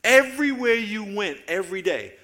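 strong preacher's call, EVERYWHERE leaned on; preaching loud. Real, not acted. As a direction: open the list with full preaching force. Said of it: "opening sermon line, building".

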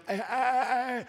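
high-pitched stalling "ah, ah, ah"; comic high noise of reluctance; hesitant stammered "ah". Acted. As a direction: balk on a high, drawn-out "ah". Balking at saying the words.

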